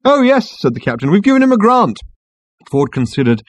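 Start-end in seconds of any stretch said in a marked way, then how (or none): 0.0-0.6 high, loud cheery reply; raised-voice assurance; captain calling back. A narrator's reading. Captain calling over the bagpiper. 1.1-2.0 high, loud explanation; raised-voice justification; captain calling back. A narrator's reading. Captain calling over the bagpiper.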